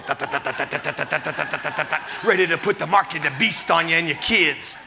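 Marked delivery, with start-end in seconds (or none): 0.0-2.1 low, soft staccato ta ta ta; horror movie stabbing sound. Retold or imitated. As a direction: imitate the slasher film sting. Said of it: "a guy in a mask going".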